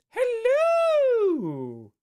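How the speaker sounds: very high, loud, long-held hello; big falsetto movie-quote call; Doubtfire greeting. Acted. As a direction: hoot it high and stretch the last vowel.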